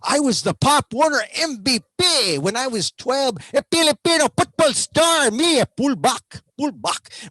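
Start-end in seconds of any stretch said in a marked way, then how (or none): 3.5-7.3 put-on high Filipino-accented boast; hammed-up Filipino accent, proud of the football star; mock Filipino accent.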